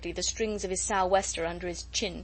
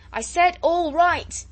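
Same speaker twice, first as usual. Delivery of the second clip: high, loud, slow shout; cried out; 9 semitones higher, 8 dB louder, slower than usual. A narrator's reading. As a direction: shout it slowly to be heard over wind. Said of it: "cried Captain MacWhirr".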